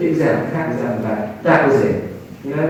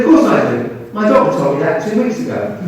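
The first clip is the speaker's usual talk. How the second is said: louder, a sharp put-down; triumphant retort; 7 semitones higher, 7 dB louder than usual. Retold or imitated. Lucy putting the creepy man in his place, loud. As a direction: land it like a winning comeback.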